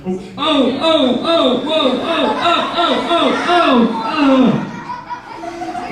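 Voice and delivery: high laughing robot oh-oh chant; robot moves. Retold or imitated. Dancing the robot in the shower, high oh-oh chant. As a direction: chant it mechanically while laughing. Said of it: "I'm like doing the robot".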